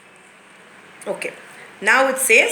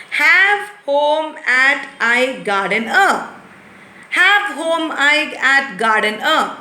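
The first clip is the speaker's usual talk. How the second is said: slow jumbled reading; 3 semitones higher, slower than usual. Shown as a demonstration. Teacher shows the children how jumbled words sound. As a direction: read each muddled word slowly and separately. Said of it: "If somebody talks to you like that way".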